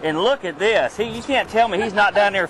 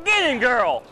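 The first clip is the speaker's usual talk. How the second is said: higher cheer, punching get; cheering on a fight; 5 semitones higher than usual. Real, not acted. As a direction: urge her on, falling. Cheering on a catch.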